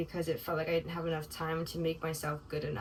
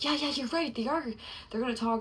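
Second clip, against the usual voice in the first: much higher, anxious giving in; anxious agreement; 7 semitones higher than usual. Retold or imitated. Caving to the anxious voice, a high anxious agreement. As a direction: blurt it out high as the worry wins.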